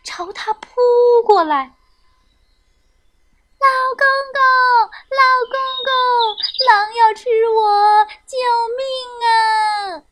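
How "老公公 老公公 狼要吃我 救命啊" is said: high, loud cat's cry for rescue; terrified high-pitched plea; crying out for help. A narrator's reading. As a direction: call out in panic, voice pitched high, stretching the last word. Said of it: "大花猫大叫了起来".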